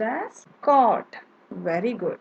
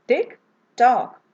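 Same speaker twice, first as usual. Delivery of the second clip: "Tick tock" called out louder for the class; loud model tick tock; 5 semitones higher, 9 dB louder than usual. Shown as a demonstration. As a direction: call out "Tick tock" for them to repeat. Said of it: "So say it aloud".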